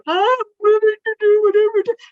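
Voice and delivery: an over-the-top melodramatic pledge; dramatic mock vow. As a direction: ham up the big dramatic promise. Mocking dramatic over-commitment.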